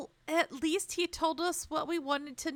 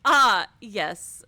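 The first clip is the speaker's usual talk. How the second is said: ordinary delivery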